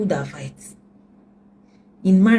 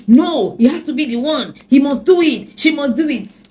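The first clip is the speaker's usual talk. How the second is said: insistent demand; 7 semitones higher, 7 dB louder than usual. Retold or imitated.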